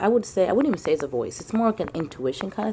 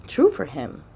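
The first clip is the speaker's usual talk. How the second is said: ordinary talk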